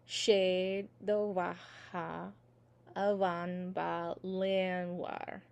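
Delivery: very slow, evenly drawn-out Irish syllables; slow recitation together. Shown as a demonstration. Teacher saying let's do those all together, slow recitation. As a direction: lead learners through it at half speed. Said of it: "let's do those all together".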